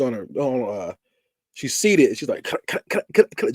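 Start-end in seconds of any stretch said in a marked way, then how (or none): 2.4-3.5 voiceless choking sounds, done as a light mock; imitated choking gasps. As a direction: gasp out the dying character's choke. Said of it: "just like that. That's how she's looking".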